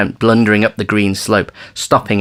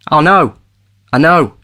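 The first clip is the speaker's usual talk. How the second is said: hasty; 8 semitones higher, 6 dB louder than usual. Acted. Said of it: "Ven put in hastily".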